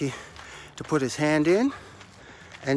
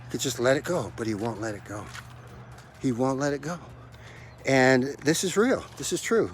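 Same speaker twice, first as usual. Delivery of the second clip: ordinary talk, nothing marked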